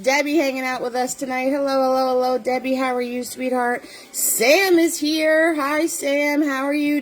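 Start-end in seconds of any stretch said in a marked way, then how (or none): none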